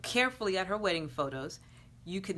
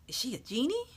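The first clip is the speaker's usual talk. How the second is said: surprised; 7 semitones higher, faster than usual. Acted. Startled question, +7 far.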